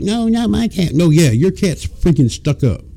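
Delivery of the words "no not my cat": high mock girlfriend protest. Girlfriend defending her cat, mock high protest. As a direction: imitate her huffy denial, pitched up. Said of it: "She's like".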